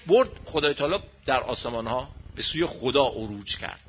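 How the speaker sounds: ordinary talk, nothing marked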